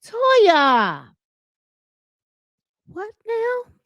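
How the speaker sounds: exasperated. Real, not acted. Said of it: "I'm like".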